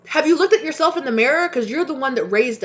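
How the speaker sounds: heated accusing question; raging. Real, not acted. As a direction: throw it back at the parents. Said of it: "you guys see me raging".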